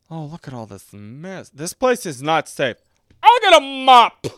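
shrill it out like a fussbudget eyeing the mess; fussy character voice. Retold or imitated. Mimicking fussy character cleaning up.

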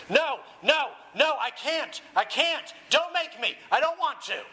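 high, frantic acted pleading; hammed-up terrified begging; screaming protest. A narrator's reading. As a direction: cry out in panic, pitched far up. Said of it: "Dumbledore screamed".

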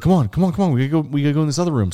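high, fast, hurrying voice; urgent coaxing. Retold or imitated. Friend hurries the dreamer into another room, urgent coaxing. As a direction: imitate a friend rushing someone along.

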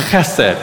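Chesed with a throaty ch, then sed; Hebrew pronunciation. Shown as a demonstration. Pastor saying the word with the congregation, throaty ch. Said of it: "One more time".

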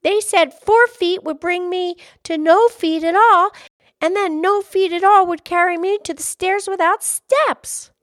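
put-on high, loud, insistent little girl; crying out. A narrator's reading. Girl giving the fish the message, crying out. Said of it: "she cried".

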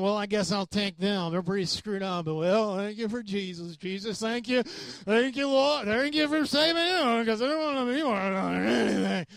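mock a snooty believer thanking God; smug reluctant chooser.

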